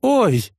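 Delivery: sharp high yelp; startled high cry; yelp of pain. Acted. Girl slapped from behind. (вскрик от боли)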